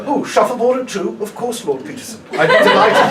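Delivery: lightly mock a genteel murder-mystery guest; posh Agatha Christie guest. Comic mocking posh Agatha Christie cruise passengers. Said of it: "I thought it was going".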